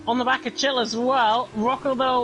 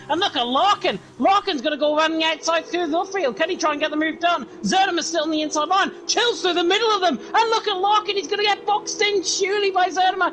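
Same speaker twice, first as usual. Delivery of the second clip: motorsport commentator; 5 semitones higher than usual. Real, not acted. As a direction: call the overtake with building excitement. Close battle called live.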